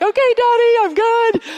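a small daughter reassuring her dad on the ski slope; little girl voice. Retold or imitated. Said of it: "be like".

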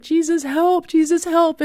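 high, pleading cry for help; desperate plea. Retold or imitated. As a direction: beg in a panic, pitched high. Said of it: "And it was like".

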